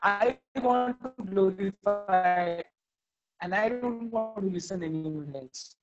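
ordinary talk

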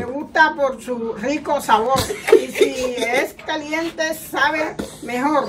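slow, spaced-out ad reading. Shown as a demonstration. Mother doing last take to camera, slow reading. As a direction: read it out word by word, slowing down. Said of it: "Mirando la cámara".

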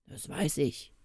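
lightly voiced sleepy ogre; ogre's growl. A narrator's reading. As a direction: growl it low-key, half asleep. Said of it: "knurrte der Menschenfresser".

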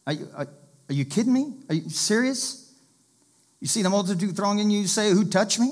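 high, disbelieving modern-day retort; incredulous disbelief. Retold or imitated. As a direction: throw it back like the crowd is obvious. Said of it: "in modern day language".

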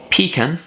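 "Pecan" as a first, careful try; pecan pronunciation. Shown as a demonstration. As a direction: say "Pecan" as a word being tested. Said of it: "you just say pecan".

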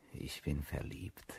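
slow, breathy whisper; whispered confession. Acted. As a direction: confess it under your breath. Gesteht leise seine Liebe. (geflüstertes Geständnis)

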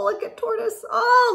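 ordinary delivery

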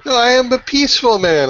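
lightly acted high-pitched protest; peaceful man protesting. Pirate crewmate to his companions, mild protest.

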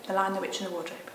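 put-on expressive read-aloud to children; storybook narration; story reading. A narrator's reading. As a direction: read the story with feeling, letting the scene build.

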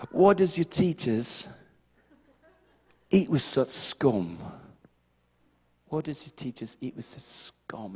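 slow, soft mock British sneer; British accent. Pharisees sneering at Jesus in a mock British accent. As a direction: drawl it like a snooty British Pharisee. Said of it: "speak in a British accent".

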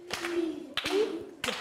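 Pig split into its three sounds; sounds clapped out. Shown as a demonstration. Teacher to class, clapping the sounds of pig. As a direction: clap out each sound of pig.

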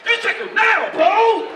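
very loud, high outburst; acted emphatic shout. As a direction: yell it as the indignant attacker. Acted attacker answers his own question.

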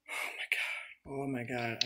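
whispered, slow, drained; exhausted whisper. Real, not acted. Streamer drained after raging, whispered. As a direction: let it out as a spent whisper.

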